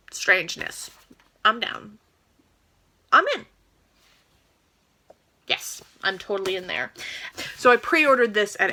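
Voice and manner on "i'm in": high, bright jump in pitch; sudden delighted squeal of agreement; genuinely thrilled it's a yes; excited. Real, not acted. Eager to read the book.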